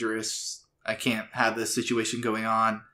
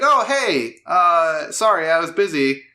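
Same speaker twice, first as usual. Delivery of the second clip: voicing Mitsuboshi; 5 semitones higher, 5 dB louder than usual. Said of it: "He walks in like".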